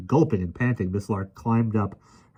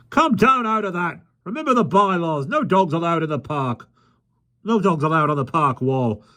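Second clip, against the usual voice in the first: high, fussy scolding official; fussy park keeper; 10 semitones higher than usual. A narrator's reading. Park keeper scolds the dog on the wall, high fussy voice.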